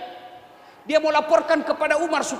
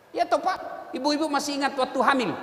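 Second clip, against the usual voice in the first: ordinary talk, nothing marked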